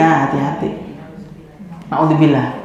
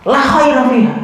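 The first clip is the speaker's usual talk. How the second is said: raised, hammed-up stern La khaira fiha; a touch of mock anger; mock angry prophet; 6 semitones higher than usual. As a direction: play an angry scold, half joking. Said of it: "Rasul nggak mungkin gini-gini".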